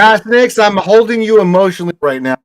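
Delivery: high, fast, effusive thanks; gushing excitement. Real, not acted. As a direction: gush with gratitude. Agradecimiento emocionado.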